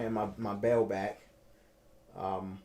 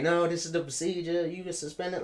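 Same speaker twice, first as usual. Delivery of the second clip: imitating a stern officer, higher pitch; 7 semitones higher than usual. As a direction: retell it as an officer insisting on procedure. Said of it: "like, stern".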